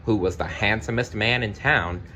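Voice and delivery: ordinary delivery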